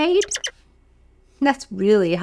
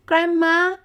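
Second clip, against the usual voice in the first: put-on higher, wondering girl voice; little girl; 6 semitones higher than usual. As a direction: sound amazed and innocent.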